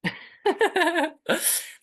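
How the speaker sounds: high, loud burst of laughter; real laugh. Real, not acted. Reacts to the host's introduction.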